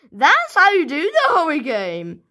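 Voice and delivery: usual speaking voice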